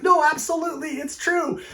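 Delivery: very high, squeaky-pitched retold protest; emphatic insisting. Speaker convincing the doubting Australian about magpies, high-pitched insistence. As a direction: swear it's true, pitch shooting way up.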